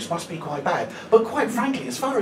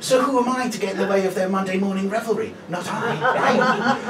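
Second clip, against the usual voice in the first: ordinary delivery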